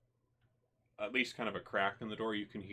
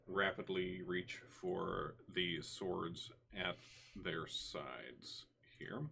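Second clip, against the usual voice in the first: ordinary talk, nothing marked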